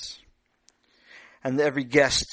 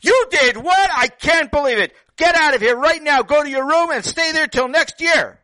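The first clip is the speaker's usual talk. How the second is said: much higher, much louder furious parent yell; shouting at the top of the voice; classic parent yelling; 14 semitones higher, 9 dB louder than usual. Shown as a demonstration. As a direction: blast it like an angry parent sending a kid to his room. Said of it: "There's the classic yelling".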